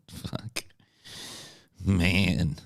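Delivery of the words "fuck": hushed, voiceless swear; whispered curse. Real, not acted. Streamer reacting to his own thought, whispered curse. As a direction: let it out under your breath. Muttered reaction after a laugh.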